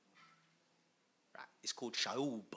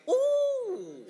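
delighted ooh; 10 dB louder than usual. Real, not acted.

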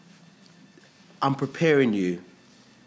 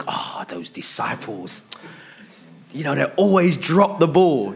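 smug mock judgment; 5 semitones higher, 8 dB louder than usual. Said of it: "you think".

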